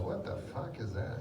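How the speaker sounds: low, soft aside as an inner thought; thinking to oneself. Retold or imitated. Gambler puzzling to himself about addiction, quiet inner voice. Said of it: "I always think to myself".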